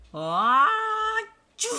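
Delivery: loud, high comic achoo; big fake sneeze. Elephant sneezes, loud and high. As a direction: sneeze it out hugely.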